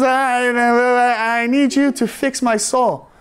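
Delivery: slow, tearful, half-retold plea. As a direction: beg slowly as if close to tears. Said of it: "I just burst into tears".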